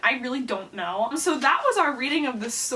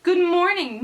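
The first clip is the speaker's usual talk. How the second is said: high, loud singsong schoolkid greeting; class greeting in chorus; 7 semitones higher, 6 dB louder than usual. Acted. Class greeting their teacher, high singsong chorus. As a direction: chant it brightly like a class in unison. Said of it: "said our class".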